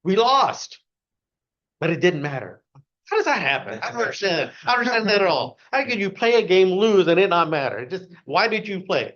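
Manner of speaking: high, loud, baffled outburst; can't believe losing doesn't matter; exasperated rant. Real, not acted. Speaker to audience about World Cup fans, loud baffled rant.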